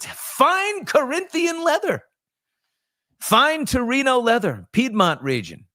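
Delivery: high, slow and grand, like a car commercial; a hammed-up commercial announcer; mock luxury ad voice. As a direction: savor the words like a pitchman selling leather.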